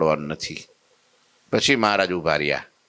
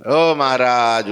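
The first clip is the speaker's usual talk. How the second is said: long drawn-out call, louder; 4 semitones higher, 6 dB louder, much slower than usual. Acted. Disciple calling after Maharaj, long loud call. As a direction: call after him from afar, slowly. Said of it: "પછી બૂમ પાડી".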